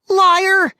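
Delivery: very high, loud scream. A narrator's reading. Sister furious at brother's accusation, screaming. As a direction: scream the insult. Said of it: "Molly screamed".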